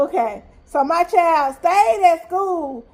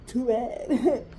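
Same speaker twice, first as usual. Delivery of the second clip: usual speaking voice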